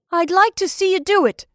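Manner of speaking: high-pitched, loud dare; defiant. A narrator's reading. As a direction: repeat the challenge defiantly, pitched well up. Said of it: "he said defiantly".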